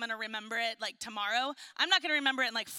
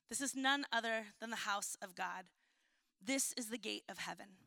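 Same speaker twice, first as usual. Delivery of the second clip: softer, measured Bible reading; scripture reading; 2 semitones lower, 5 dB quieter than usual. Acted. Reads Genesis passage aloud.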